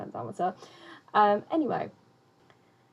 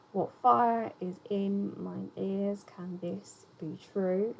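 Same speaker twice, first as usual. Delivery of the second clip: slow, measured beat, leaning on "fire", "in", "ears", "this", "true"; deliberate metrical reading, stressing every second syllable up to "true"; iambic rhythm demo; much slower than usual. Shown as a demonstration. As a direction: scan "What fire is in mine ears" slowly, foot by foot. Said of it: "is the general, uh, rhythm".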